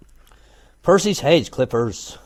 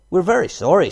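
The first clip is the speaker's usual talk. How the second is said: startled fox apology; 8 semitones higher, 6 dB louder than usual. Acted.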